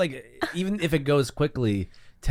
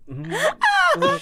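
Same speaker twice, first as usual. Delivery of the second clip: squeaky high agreeing hum; high mm-hmm. Retold or imitated. Passersby answer.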